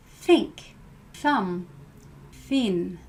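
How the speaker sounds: "think" "thumb" "thin" with breath pushed between teeth and tongue; no buzz, just air on the th; unvoiced th demo. Shown as a demonstration. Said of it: "Put your fingers on your throat again and say".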